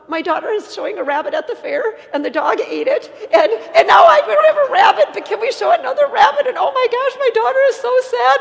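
imitated panicked wail, very high and loud; hammed-up tearful panic, half laughing, building to a frantic high pitch; crying, panicking mother. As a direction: shriek it like a mother in comic meltdown. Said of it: "crying, and she's panicking, and she's like".

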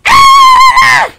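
sudden high shriek acting out the animatronic; mock jumpscare scream. Imitating the animatronic jumpscare as a sudden high shriek. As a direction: scream like a robot lunging at you. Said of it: "then he's like".

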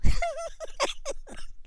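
shrill canned-style cackle after the punchline; high cackle. Real, not acted. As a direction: burst into a high cackling laugh. Host laughs at own joke.

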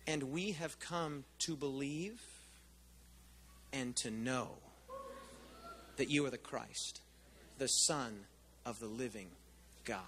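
slow, low and reverent; solemn confession. Retold or imitated. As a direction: lay each word down with awe.

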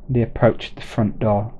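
usual speaking voice

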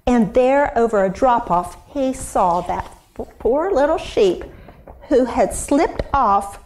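ordinary talk

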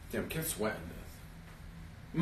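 slow breathy whisper aside. Real, not acted. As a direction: whisper it privately. Muttered aside about wig.

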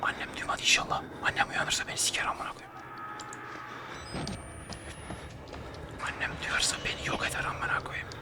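hushed whisper so mom won't wake; late-night whisper to avoid waking mom. Real, not acted. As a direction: whisper it, scared of being heard at night. Afraid mother wakes at night.

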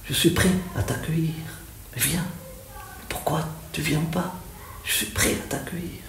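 hushed, intimate pleading; soft invitation. Acted. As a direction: invite softly, almost under your breath. Voices the poet inviting the Prophet. (invitation à voix basse)

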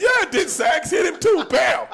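high, loud, slow whoop; excited shout. Real, not acted. Retelling Sax's punch to the host, excited shout. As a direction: bellow it with glee.

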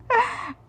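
a brief, high burst of real laughter; high giggle. Real, not acted. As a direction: laugh at feeling silly. Laughing at herself.

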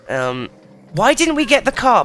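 ordinary talk

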